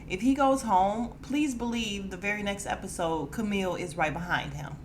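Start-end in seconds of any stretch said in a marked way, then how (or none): none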